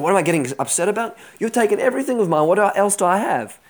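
high, fast acted protest; indignant protest. Robbed Micha protests to the men of Dan, indignant.

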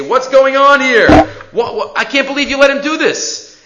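high, loud outraged demand; high, loud incredulous complaint; indignant townspeople. Acted. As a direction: accuse the father. Townspeople confront Gidon's father.

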